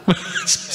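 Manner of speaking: quiet breathy giggle; real soft laughter; amused laugh. Real, not acted. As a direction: giggle helplessly under your breath. Laughing at the video.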